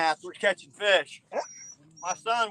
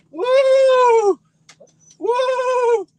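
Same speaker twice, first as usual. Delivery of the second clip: high loud joyful whoop; excited whoop; 10 dB louder than usual. Real, not acted. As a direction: whoop with delight. Excited whoops over big fish.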